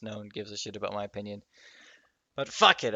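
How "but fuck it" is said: sudden loud burst; venting. Real, not acted. Podcaster deciding to vent anyway, loud defiant burst. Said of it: "I feel like venting".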